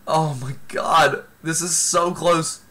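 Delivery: ordinary talk, nothing marked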